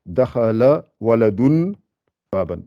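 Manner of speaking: "dakhala waladun baban" slow and careful; slow Arabic example. Shown as a demonstration. Teacher reading a sample sentence to the class, slowly. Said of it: "j'aurais mis".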